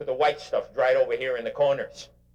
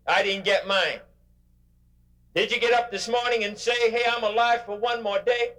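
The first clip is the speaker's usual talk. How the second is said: raised, high, driving preacher's voice; preaching at full force; 9 semitones higher than usual. Acted. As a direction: lift the room with every line. Motivational speaker preaching to crowd.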